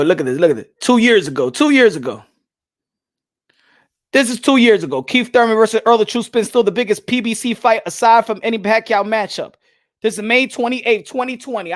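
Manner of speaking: loud, pumped-up hype; hyped. Real, not acted. Streamer hyping his old video to chat, loud.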